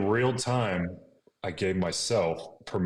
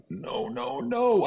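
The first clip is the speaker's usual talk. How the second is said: pleading no; much slower than usual. Retold or imitated. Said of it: "I said that, like, out loud".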